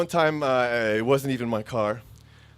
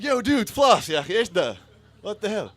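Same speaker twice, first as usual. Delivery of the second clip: laughing guard quote; 8 semitones higher, 4 dB louder, faster than usual. Retold or imitated. Checkpoint guard yelling at him.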